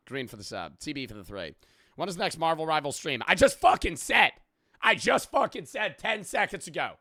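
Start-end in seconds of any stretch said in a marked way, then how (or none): 3.3-6.9 loud, high, exasperated outburst; exasperated shout. Real, not acted. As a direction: yell it at someone who didn't listen. Exasperated at repeated chat question.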